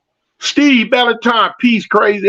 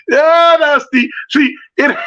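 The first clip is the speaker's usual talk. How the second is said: bursting laugh; 6 semitones higher, slower than usual. Real, not acted. Streamer laughing aloud at a viewer's comment.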